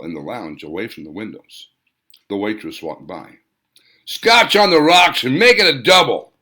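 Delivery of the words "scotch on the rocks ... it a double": loud, high demanding bark; barked order. Acted. Hans barking his drink order at the waitress, loud demand. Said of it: "Hans barked".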